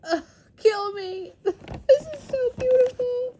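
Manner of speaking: high, tearful gushing; real high-pitched, choked-up delight; overwhelmed squeal. Real, not acted. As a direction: squeal as if moved to tears. Overwhelmed reaction to gift packaging.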